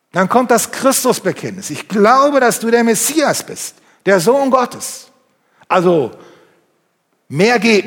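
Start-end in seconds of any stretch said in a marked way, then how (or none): 1.7-5.1 loud proclamation. Acted. Preacher voicing Martha's confession to the congregation, proclaimed loud and high. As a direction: proclaim it loud and high, slowing on Sohn Gottes.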